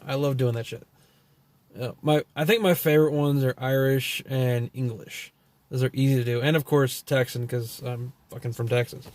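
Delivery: usual speaking voice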